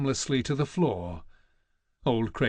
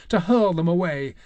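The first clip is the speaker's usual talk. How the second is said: plain reading voice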